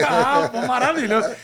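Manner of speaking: loud, delighted praise; excited. Real, not acted. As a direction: cheer it, thrilled. Host praises family excitedly.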